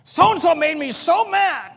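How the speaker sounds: loud, high, drawn-out mock grievance; mock outraged whine, slow and loud; mimicked complaint. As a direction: imitate someone griping at the top of their voice. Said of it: "People have to say".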